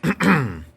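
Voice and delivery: a short, sharp throat clear. A narrator's reading. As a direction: clear your throat before speaking. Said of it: "Yo aclaré la garganta".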